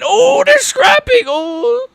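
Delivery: high, drawn-out excited shout; real excited yelling. Real, not acted. Streamer reacting to a fight breaking out, excited yelling. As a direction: yell it as the brawl breaks out.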